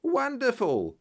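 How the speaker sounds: high, bright exclamation of delight; a delighted outburst, pitched high; excited cry. A narrator's reading. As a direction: throw your hands up and cry it out. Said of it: "he cried with his hands up".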